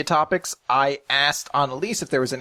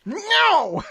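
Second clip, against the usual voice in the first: high mock-furious "No!"; hammed-up shriek; mimicked outburst. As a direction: echo the scream in comic rage. Co-host mimics the angry refusal.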